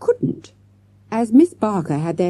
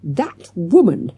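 slow, spat-out outrage; teacher exploding at her rival; exploding in anger; 2 semitones higher, 4 dB louder, slower than usual. A narrator's reading. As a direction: fling the words at her enemy. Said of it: "Miss Barker exploded".

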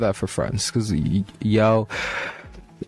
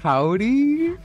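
a drawn-out Texan howdy, pitched up high; cowboy howdy; 13 semitones higher than usual. Shown as a demonstration. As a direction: greet like a cowboy on the farm. Cowboy greeting acted for Texas.

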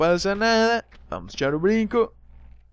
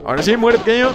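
loud, raised battle taunt; attack shout; 6 semitones higher, 7 dB louder, faster than usual. Real, not acted. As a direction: call it out as you go for the kill. Attacking a small game enemy. (grito de ataque al enemigo)

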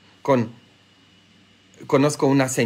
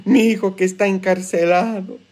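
mock sobbing plea to the priest; put-on tearful lament, higher pitched; imitated weeping mothers; 4 semitones higher than usual. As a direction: imitate a mother crying for her son. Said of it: "Todos llorando por sus hijos".

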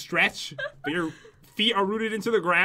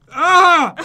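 frustrated yelp; 9 semitones higher, 12 dB louder than usual. Real, not acted. Frustrated outburst.

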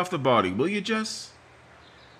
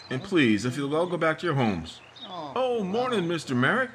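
normal talking voice

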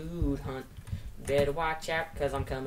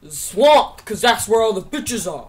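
higher, much louder comic shout; 7 semitones higher, 15 dB louder, slower than usual. Pretend. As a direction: belt the punchline. Joke punchline shouted.

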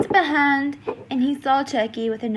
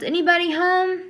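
higher-pitched, mild call-out; yelled call; 6 semitones higher than usual. A narrator's reading. Haley searching Dalton's house, calling out in a mild yell. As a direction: call out lightly, drawn up high. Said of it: "She yelled".

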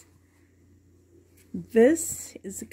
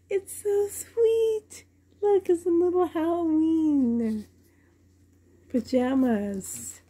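gushing delight; 7 semitones higher than usual. Real, not acted. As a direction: coo high over something adorable. Gushing over tiny baby outfit.

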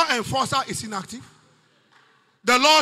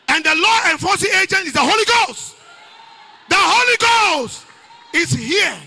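rising into a preached climax; preaching; 4 semitones higher, 5 dB louder than usual. Acted. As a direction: preach it out to the congregation.

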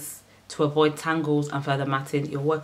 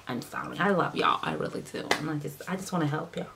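soft apology; 7 dB quieter than usual. Real, not acted. Softly apologising to viewers.

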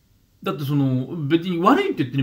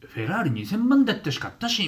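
Osaka dialect imitation; 8 semitones higher than usual. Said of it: "両方とも大阪弁で".

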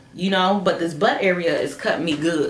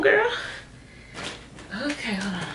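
usual speaking voice

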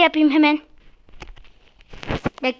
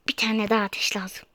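hushed whispered aside; whispering; 6 semitones lower, 9 dB quieter than usual. Real, not acted. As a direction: whisper it to yourself.

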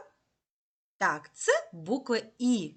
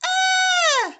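"е" sung very high, like a mouse; high squeak; 6 dB louder than usual. Shown as a demonstration. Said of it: "Високо, низько". (високе «е», як пищить мишенятко)

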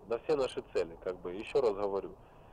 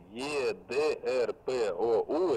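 slow letter-by-letter spelling; dictating letters; much slower than usual. Real, not acted. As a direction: spell it out slowly. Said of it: "повторяю по буквам".

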